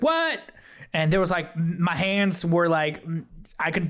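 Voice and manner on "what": high startled what; surprised what. Retold or imitated. Waking from the cocoon, startled high. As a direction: jolt up in surprise. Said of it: "I was like".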